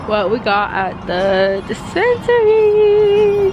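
high and slow, drawn out; stoned, stretching every word; stoner. Real, not acted. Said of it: "I'm pretty high right now".

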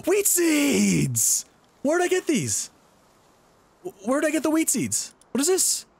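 very high, excited squeal; thrilled surprise. Real, not acted. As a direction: squeal with delight at an unexpected find. Surprise find of wheat seeds.

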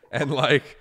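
ordinary delivery

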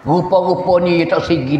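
normal talking voice

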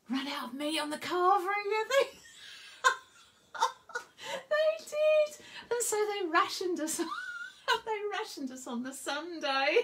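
high laughing delivery, breaking up; giggling. Real, not acted. Telling friends about the rationed carvery, laughing high. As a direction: laugh between the phrases.

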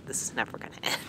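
whispered mock despair. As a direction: breathe it out like giving up. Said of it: "but I was like".

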